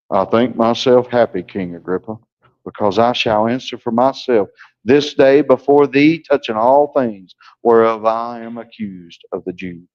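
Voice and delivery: mock a boring preacher, lifeless and low; dull droning preacher. Shown as a demonstration. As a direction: stiff preacher who just stands and drones. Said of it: "preachers that just stand like this and they talk like this".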